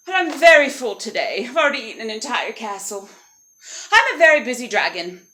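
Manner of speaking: loud, emphatic storybook dragon; self-important dragon. Acted. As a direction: play a dragon too busy to bother. Dragon dismisses the princess.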